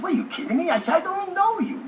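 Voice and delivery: can't believe what he's hearing; incredulous disbelief. Retold or imitated. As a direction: pitch shoots way up in shock. Incredulous reply to the bet.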